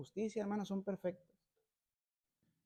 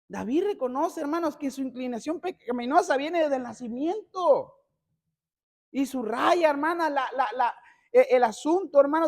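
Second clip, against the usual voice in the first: loud, high, hammering sermon delivery; full-force preaching; 4 semitones higher, 12 dB louder than usual. Acted. Preacher hammers original sin home to his congregation, loud and high. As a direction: preach it out at the top of the voice.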